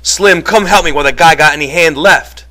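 high, loud, repeated shouted commands; urgent shouting. A narrator's reading. George begs Lenny and Slim over Curly's hand, shouting. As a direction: shout urgently to stop him and summon help. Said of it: "George shouted over and over".

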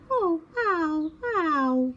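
high, hurt little ows; little owl hooting in pain; little owl yelp. A narrator's reading. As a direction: bump your head and yelp. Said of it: "he hooted".

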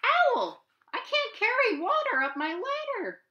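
very high, loud desperate call; Rabbit crying out. Acted. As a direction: cry out helplessly as Rabbit. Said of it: "cried Rabbit".

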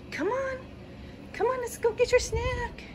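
very high sing-song call; high dog-talk voice. Real, not acted. Owner coaxing her dog to snack time in high voice. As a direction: squeak it up to coax her.

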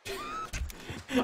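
high sudden laugh that falls away; high laugh, falling; high burst of laughter. Real, not acted.

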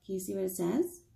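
ordinary delivery, nothing marked

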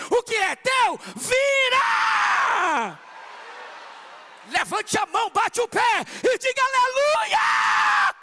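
loud, high, full-force preaching; fiery preaching. Acted. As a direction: preach it to the whole hall.

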